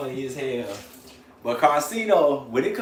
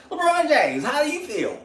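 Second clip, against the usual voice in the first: mock interviewer; 12 semitones higher than usual. Mimicking Carcino's Old Man Bron video.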